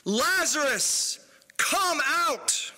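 high, drawn-out commanding call; loud cry. A narrator's reading. Preacher voicing Jesus calling a dead man out, loud cry. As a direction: call out the summons slowly, voice lifted high. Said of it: "he cried out with a loud voice".

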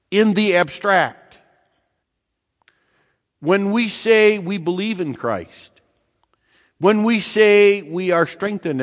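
high, impassioned sermon voice; fervent preaching. Acted. Preacher urges the congregation, high and impassioned. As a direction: drive it home from the pulpit.

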